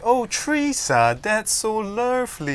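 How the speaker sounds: high, drawn-out mock British Singaporean accent; ridiculous British-blend accent. Mum gushing to British grandma, exaggerated British-blend accent. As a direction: imitate mum putting on a British accent, slowly. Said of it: "suddenly the accent changes to this ridiculous blend of British".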